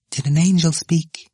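plain reading voice

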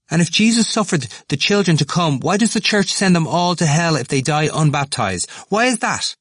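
high, fast, eager voice; excited young man. Acted. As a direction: rush it out, worked up. Temple's high voice.

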